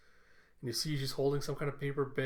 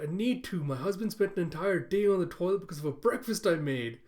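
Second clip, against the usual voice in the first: slightly hammed-up pleading, pitched higher; mild mock desperation; 5 semitones higher, 5 dB louder than usual. Yor tells coworkers of her bad breakfast, mildly desperate. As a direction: explain a crisis in a raised, earnest voice. Acts out Yor's desperate explanation.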